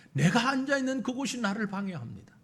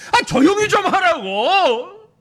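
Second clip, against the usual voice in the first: angry scolding; 8 semitones higher, 10 dB louder, slower than usual. Acted. Crowd scolding louder.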